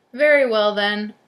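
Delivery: loud, grudging troll dismissal; booming storybook monster; growling troll. A narrator's reading. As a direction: growl it out and send the goat off. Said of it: "growled the troll".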